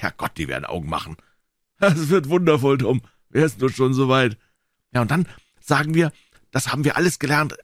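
put-on high-pitched boys' voices; high, eager kid chatter; boys bragging, excited. A narrator's reading. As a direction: play boys crowing over a rival.

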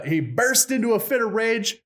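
ordinary talk

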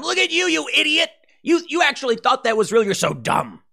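high, sneering mock voice, taunting; a nasty, high-pitched taunt at the waking man; mocking jeer. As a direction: jeer at him like a schoolyard bully. People outside mocking Neo.

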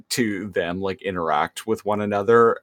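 ordinary talk

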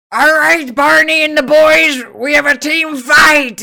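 loud, high captain's yell up to the men; yelling to his crew. Acted. Captain yells up to his men to fight. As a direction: bellow the order up to the crew. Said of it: "you just hear him yell that".

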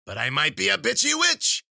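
loud, high crone's crowing; high cackly punchline delivery; gleeful witch. Acted. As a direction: shriek it with wicked delight. Witch delighted by her own joke.

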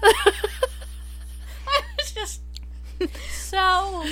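laughing. Real, not acted. Cracking up at grave joke.

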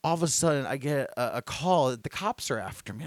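normal talking voice

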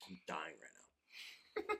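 wasabi burn in the nose; breathy pained whisper; strained whisper. Real, not acted. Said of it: "It just hit me square in the nose".

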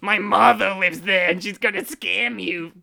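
put-on cartoon character voice; Missus Bighead impersonation. Host warning about a scamming mother, put-on cartoon voice. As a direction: play it as a comic cartoon figure. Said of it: "Sounds like you're doing, like, what's her name".

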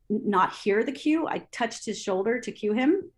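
usual speaking voice

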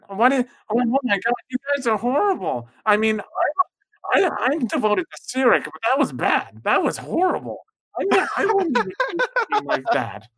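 high, appalled NPC outburst; the cultist reels in disgust; horrified Ozar. Acted. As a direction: NPC horrified at the party's massacre, high and outraged. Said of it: "Ozar looks at you guys and says".

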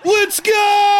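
high excited yell; hype yell. Real, not acted. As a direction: shout it with hype.

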